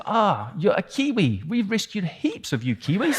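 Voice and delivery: put-on high, swooping German-accented exclamation. German officer recognises a rescued New Zealander, delighted German accent. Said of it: "he said in his German accent".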